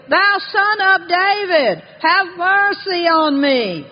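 high, slow, insistent cry; crying out harder. Retold or imitated. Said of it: "he cried all the more a great deal".

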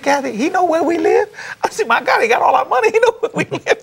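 high, laughing disbelief; mock amazed squeal; incredulous. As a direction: ask it like you can't believe your ears. Reverend retells disbelief at caller.

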